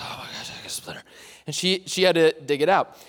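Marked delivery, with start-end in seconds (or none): 0.0-1.0 fast, hushed mock-horror whisper; traumatized whisper. Kindergartner shaken by splinter, mock-horror whisper. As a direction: whisper it like a shaken little kid. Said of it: "I was".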